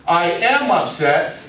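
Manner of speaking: slow "I am upset" with weight on "am"; stress on AM. Shown as a demonstration. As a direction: lean on "am" to affirm it. Exposes the real meaning.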